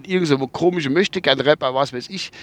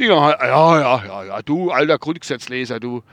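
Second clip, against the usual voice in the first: ordinary delivery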